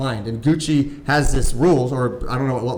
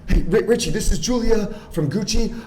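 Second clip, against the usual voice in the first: mock breathless, higher excited voice; breathless excited caller; 8 semitones higher than usual. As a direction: play her rushed and out of breath. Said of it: "she's, like, breathing heavy".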